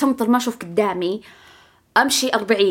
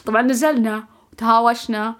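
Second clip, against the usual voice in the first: ordinary talk, nothing marked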